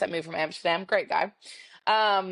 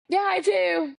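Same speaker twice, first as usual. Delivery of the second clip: awkward high reply; 12 semitones higher, slower than usual. Retold or imitated. Awkward replies to bartender.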